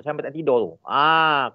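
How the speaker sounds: ordinary delivery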